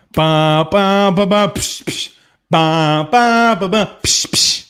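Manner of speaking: beatboxed drum sting; mock rimshot. After his punchline, host beatboxes a drum sting. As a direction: punch the joke with a drum beat.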